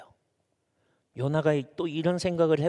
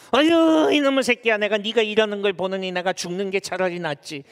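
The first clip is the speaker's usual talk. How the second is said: loud high groan settling into grumble; exasperated parent; 3 semitones higher, 3 dB louder than usual. Acted. Parent scolds their child, acted exasperation fading to grumble. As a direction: burst out scolding, then ease off. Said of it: "자식들한테도 많이 쓰죠".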